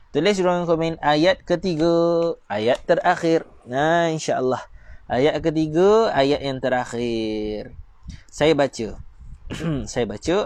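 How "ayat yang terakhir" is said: ordinary talk, nothing marked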